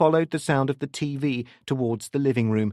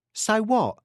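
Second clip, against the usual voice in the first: higher-pitched, defiant comeback; 12 semitones higher than usual. Acted. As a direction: challenge them in a higher voice. Suspicious mother answers police at door.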